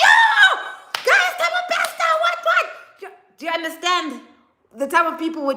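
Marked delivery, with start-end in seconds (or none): 0.0-2.7 high, loud shout of fake excitement; mock hyped shout. Vlogger mocking a hyped-up crowd online, shouting high and loud. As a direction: yell it like a crowd gone wild over gossip.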